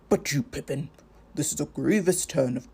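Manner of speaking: a hint of an old wizard, dismayed; lightly voiced wizard, heavy and troubled; grave wizard. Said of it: "Gandalf speaks to Pippin".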